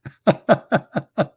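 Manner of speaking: high, staccato chuckle; real laughter. Real, not acted. Comedian amused by his own spicy food joke, real high laughing. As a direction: let out a run of high ha-ha-has.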